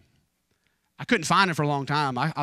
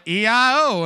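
put-on toddler answering; 8 semitones higher, 8 dB louder than usual. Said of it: "and I said".

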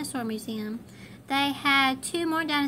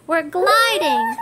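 high, loud, slow delighted laugh; riding the Microraptor, laughing; laughing with joy; 7 semitones higher, 7 dB louder, slower than usual. Acted. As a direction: laugh through it, thrilled. Gliding ride.

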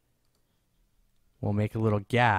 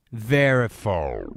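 slowed down like a record stopping; record stop effect; 4 dB louder, much slower than usual. Shown as a demonstration. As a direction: drag it down like a record winding to a stop. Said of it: "make it sound like it slowed down like a record".

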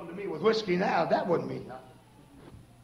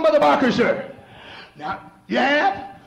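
ordinary talk, nothing marked

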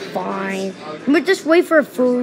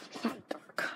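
whisper; 22 dB quieter than usual. Pretend. Lights out whisper.

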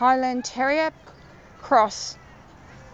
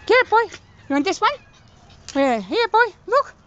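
high, loud coaxing calls to a dog; excited dog call; 8 semitones higher, 3 dB louder than usual. Real, not acted. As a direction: rev the dog up to fetch. Urging dog to fetch.

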